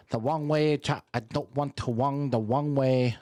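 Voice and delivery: put-on Filipino accent with Rs turned to Ws; mock Filipino English, every syllable carefully placed. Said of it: "That's how the Filipino talk".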